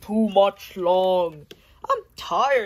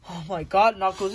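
ordinary talk, nothing marked

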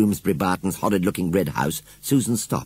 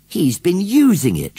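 high, loud, drawn-out exclamation; crying out; 11 semitones higher, 8 dB louder than usual. A narrator's reading. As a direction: cry it out in astonished discovery. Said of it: "she cried".

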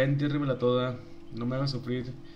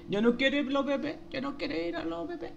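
mock baby talk; 11 semitones higher than usual. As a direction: coo at them like a baby. Teasing chat with baby talk. (habla de bebé burlona y aguda)

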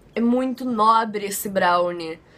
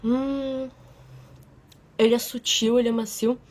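chewing while talking; mouth full chewing. Real, not acted. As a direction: lips licked mid-chew. Seen 2: bites brownie at -2 to -1, chews with closed lips, then talks.

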